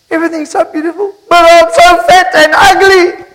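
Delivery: high, loud, wailing self-pity, stretched at the end; tearful wife. Acted. Wife crying to her husband before the party, hammed-up wail. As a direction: sob it out over the mirror, hammed-up. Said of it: "She says".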